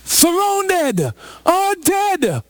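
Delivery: high-pitched mock panic wail; panicked scream. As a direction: cry out in exaggerated terror. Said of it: "panic and a scream and say".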